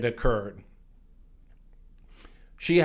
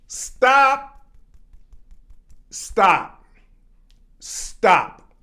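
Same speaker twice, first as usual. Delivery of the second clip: loud, raised one-word order, spaced out; firm command; 8 semitones higher, 7 dB louder, much slower than usual. Shown as a demonstration. Actress halting a yelling co-star, firm and loud. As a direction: cut them off with separate forceful stops. Demonstrating how to stand up to yelling.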